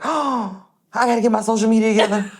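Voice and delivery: high, fast panicky rush; frantic self-quote, high and quick; panicked. Retold or imitated. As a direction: blurt it out in a panic.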